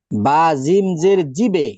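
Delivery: জিবে said slowly, with the bounce ending on এ; slow teacher drill of জিবে; qalqala demo. Shown as a demonstration. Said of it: "এরপরে আমরা দেখি". (এ ধ্বনিতে ধীরে জিবে)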